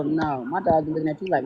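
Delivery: ordinary delivery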